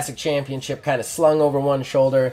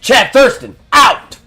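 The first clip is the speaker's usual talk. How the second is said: much higher, much louder sign-off yell; yelling wrestler; 10 semitones higher, 10 dB louder than usual. Acted. Heel wrestler ending the promo with a yell, loud and defiant. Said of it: "It's like".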